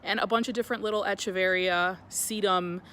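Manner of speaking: bright, loud channel welcome over music; cheerful host voice opening the video; upbeat vlog intro. Acted. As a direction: greet your viewers like a YouTube host.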